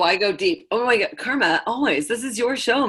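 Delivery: ordinary delivery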